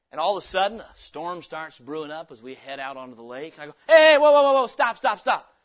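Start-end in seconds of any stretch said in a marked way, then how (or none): 3.9-5.5 loud alarmed yell to stop the boat; frightened passenger yelling whoa, stop; panicked shout. Retold or imitated.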